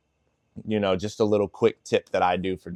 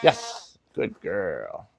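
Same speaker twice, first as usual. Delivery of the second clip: praise to dog; 3 semitones lower, 5 dB quieter, much slower than usual. Real, not acted.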